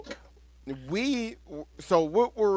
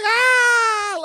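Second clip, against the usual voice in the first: high, loud mock scream; retold scream; 8 dB louder than usual. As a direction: shriek like a terrified rider. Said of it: "He's like".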